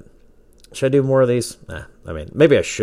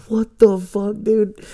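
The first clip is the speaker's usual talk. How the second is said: much higher, squeaky stoned disbelief; stunned disbelief; 4 dB louder, slower than usual. Retold or imitated. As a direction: shriek it out like you can't believe your eyes. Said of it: "We're like".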